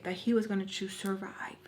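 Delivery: sarcastic hushed whisper. Acted. Mocking her own forgiveness, whispered. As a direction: breathe it mockingly.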